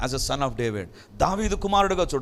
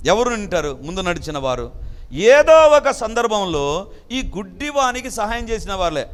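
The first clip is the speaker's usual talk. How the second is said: ordinary delivery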